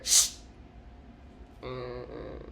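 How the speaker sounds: soft shh then low hum; quiet hushing mm-mm; shushing. Pretend. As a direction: hush them gently. Hushing the listener.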